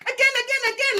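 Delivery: put-on high childish again again; mocking the club, high Teletubby chant; Teletubbies voice. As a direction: squeal it like a Teletubby. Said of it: "like Teletubbies".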